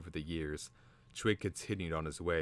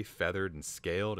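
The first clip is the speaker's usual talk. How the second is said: ordinary delivery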